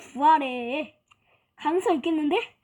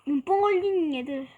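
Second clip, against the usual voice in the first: ordinary talk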